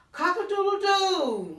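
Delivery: loud, high crowing rooster call. Acted. Teacher crowing for the barn rooster, loud and high. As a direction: crow it out like a proud rooster.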